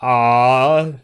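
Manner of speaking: usual speaking voice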